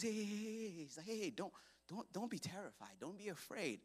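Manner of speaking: hushed, soft reassurance; gentle soothing. Acted. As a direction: soothe frightened people quietly. Moses soothing the scared people.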